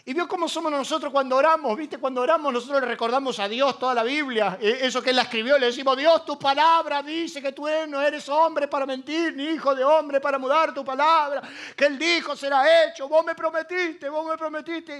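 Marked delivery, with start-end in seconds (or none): 6.0-15.0 fervent prayer. Retold or imitated. Praying, reminding God of promises.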